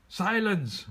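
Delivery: high loud roared command. A narrator's reading. Chief silencing the girl and bear, loud roar. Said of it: "roared the chief".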